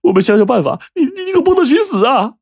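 high, stammering tearful panic; a son stammers through tears, begging his father not to die; scared crying. Acted. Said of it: "米宝就吓哭了".

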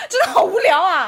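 exasperated laugh. Real, not acted. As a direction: exclaim in mock despair. Exasperated at cousin.

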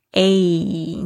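"A" stretched through a smile, gliding to ee; smiling long A. Shown as a demonstration. Teacher shows the diphthong, smiling drawn-out "A". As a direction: draw out "A" with a smile, ending on ee. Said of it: "有点像是我们微笑的时候".

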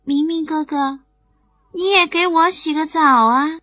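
little bunny asking a favour, sweetly; a hint of a begging rabbit; lightly voiced pleading bunny. A narrator's reading. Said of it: "凑到明明的耳边说".

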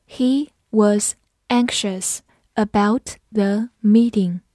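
slow, deliberate teaching read; slow classroom model sentence. Shown as a demonstration. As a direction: stretch each word out for learners.

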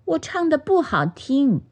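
high, shy little bird voice; bashful. A narrator's reading. Magpie doubts her singing, high and bashful. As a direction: demur shyly, pitched high. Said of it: "喜鹊红着脸说".

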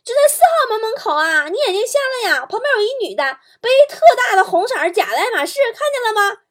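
high-pitched, irate woman shouting; brash, exasperated high-pitched holler; loud phone yelling. Acted. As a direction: yell impatiently down the phone in a shrieky high voice. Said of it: "特别大声的对着电话嚷".

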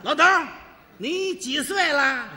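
loud 老头儿, then slow 你几岁了; mock the old man by drawing out the question slowly; cheeky loud taunt. Rude young guest asks old man's age. (年轻人戏弄老头儿，先大声后慢悠悠)

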